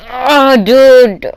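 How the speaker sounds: loud, high annoyed groan; exasperated. Retold or imitated. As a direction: sound fed up with him. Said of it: "I was like".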